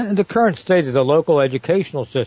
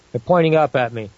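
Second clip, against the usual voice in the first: ordinary talk, nothing marked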